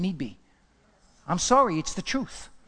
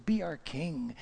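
hushed, coaxing repeat; soft acted plea; 7 dB quieter, slower than usual. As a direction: plead softly, as if in awe. Same plea, softly repeated.

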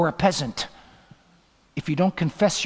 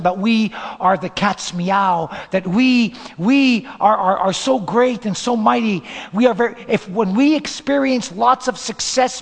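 preaching at full force; 3 semitones higher, 6 dB louder than usual. Acted. Preaching builds to a climax.

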